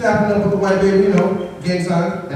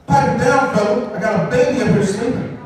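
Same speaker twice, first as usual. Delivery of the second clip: neighbor yelling; 6 semitones higher than usual. Retold or imitated. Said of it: "and he goes".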